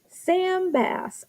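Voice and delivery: put-on high, animated American accent; drawn-out, hammed-up American accent. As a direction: play up the American accent on the name. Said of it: "you gotta say it with the, the accent".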